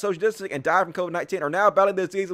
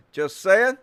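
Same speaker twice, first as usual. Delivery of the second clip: ordinary delivery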